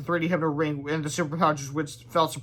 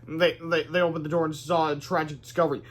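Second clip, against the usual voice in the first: plain reading voice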